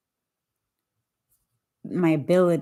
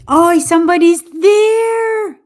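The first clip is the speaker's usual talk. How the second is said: high, loud, drawn-out surprised cry; excited shout; 12 semitones higher, 11 dB louder, slower than usual. Real, not acted. Host spotting a first viewer on the live, excited shout.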